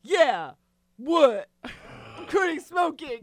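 loud, curt barks; husband snapping while quitting; mock barking. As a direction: bark each word like a dog. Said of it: "You're like".

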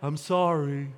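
low, half-hearted mock sorry; insincere apology. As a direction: imitate an empty apology. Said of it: "you've somehow said".

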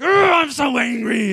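high, loud hammed-up outrage; mock angry shout. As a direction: yell it like a parody of an offended poster. Mocking the angry culture.

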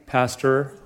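pastor in American accent, flat a and a clear r. Shown as a demonstration. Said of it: "does that even work in the accent?".

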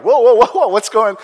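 loud, high, mock-alarmed whoas; acted kid's startled protest; alarmed reaction. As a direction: blurt it out loudly in mock alarm. Acting a kid alarmed by film.

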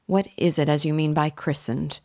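ordinary delivery

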